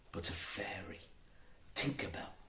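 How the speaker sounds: ordinary delivery, nothing marked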